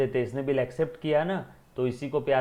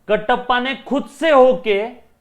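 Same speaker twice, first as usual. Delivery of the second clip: much higher and louder, slow; excited emphasis; 11 semitones higher, 12 dB louder, slower than usual. Acted. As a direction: build it up dramatically. Teacher dramatizes Katappa's act. (ज़ोरदार, ऊँची आवाज़ में)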